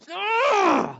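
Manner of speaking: high, strained groan of frustration; frustrated ugh. Retold or imitated. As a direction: let out a tight, pained ugh. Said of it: "utter frustration".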